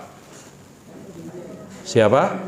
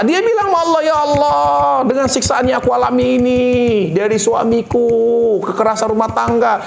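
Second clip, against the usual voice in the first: pleading prayer; 8 semitones higher than usual. Acted. Preacher voicing a beaten wife pleading to God. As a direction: cry out to God while being beaten.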